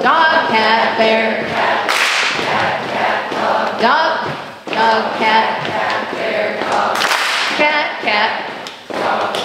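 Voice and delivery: loud called-out words. Shown as a demonstration. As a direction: call each word out over the march. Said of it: "ready, go".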